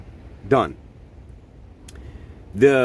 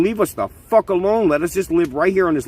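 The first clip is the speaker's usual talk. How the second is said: raised, pleading voice; pleading outcry; 7 semitones higher, 4 dB louder than usual. Acted. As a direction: cry out as if begging to be left alone.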